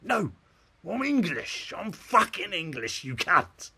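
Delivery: high, loud, slow indignant shout; hammed-up furious insistence; mock outrage. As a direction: shout it with comic exasperation. Acted outrage at being called Australian.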